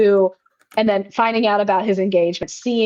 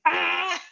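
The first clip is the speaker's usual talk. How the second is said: sudden high mock shriek; mock girlish gasp of panic; panicked shriek; 9 semitones higher, 5 dB quieter than usual. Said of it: "And they're like".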